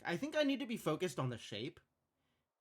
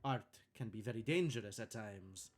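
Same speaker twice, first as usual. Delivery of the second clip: bad Italian accent; 4 semitones lower than usual. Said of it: "faking a bad Italian accent".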